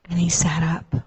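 breathy hushed whisper; hushed storyteller whisper; whispered narration. A narrator's reading. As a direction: whisper it like a secret at bedtime. Hushed moment in bedtime story.

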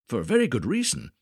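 usual speaking voice

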